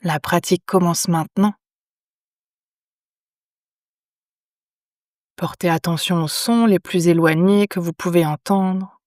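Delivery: calm yoga nidra guidance with long pauses; unhurried, measured phrases, leaving silence after each instruction; guided meditation. Acted. Teacher guiding lying listeners into yoga nidra, calm meditation voice.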